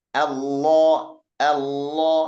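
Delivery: Allah with a thick, full lam, tongue tip pressed; heavy lam in Allah. Shown as a demonstration. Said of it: "penekanan, sangat terasa penekanan".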